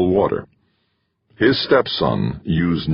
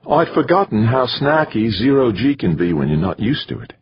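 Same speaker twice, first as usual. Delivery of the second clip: higher-pitched female character voice; woman's voice; 6 semitones higher than usual. A narrator's reading. Woman to companion on zero gravity, high female voice. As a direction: voice her with a lifted pitch.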